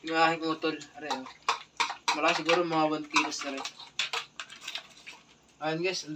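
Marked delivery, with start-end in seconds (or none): none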